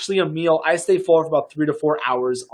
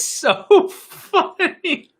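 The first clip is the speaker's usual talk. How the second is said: laughing; much slower than usual. Real, not acted. Laughing at contagious yawns.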